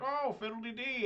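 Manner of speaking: high, prissy fake-delighted voice; a mock-genteel squeal of joy. Customer hamming up joy at the store counter.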